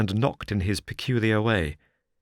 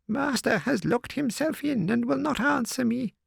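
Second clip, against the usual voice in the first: put-on much higher old woman's voice, overjoyed; old housekeeper rejoicing. A narrator's reading. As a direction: gush with relief like an old servant. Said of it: "she explained".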